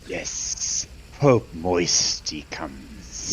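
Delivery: slow, stretched hissing creature; hissing Gorn voice. Pretend. Player announces Pope's arrival, hissing Gorn voice. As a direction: drag it out with long hissed s sounds.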